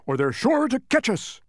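high, urgent bark; barking Tok. A narrator's reading. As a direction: bark it out like an alarmed dog. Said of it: "barked Tok".

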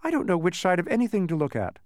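mild high-pitched protest. A narrator's reading. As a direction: object in confusion. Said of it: "protested Milo".

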